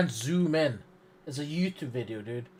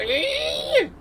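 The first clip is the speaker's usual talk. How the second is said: very high mock-furious squeal. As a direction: squeal in hammed-up outrage. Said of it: "I'd be furious".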